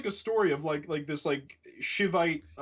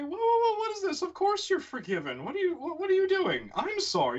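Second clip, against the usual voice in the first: mock-flustered devotee, much higher, squeaky with alarm; 14 semitones higher than usual. Devotee stunned that the sage apologizes, high flustered protest. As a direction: play the devotee shocked at the sage's apology, way up high. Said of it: "He's like".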